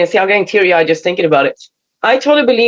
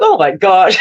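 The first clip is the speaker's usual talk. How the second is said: touched, laughing; a hint of overwhelmed delight; laughing amazement; 3 semitones lower, slower than usual. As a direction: say it with a moved laugh. Moved realization, laughing.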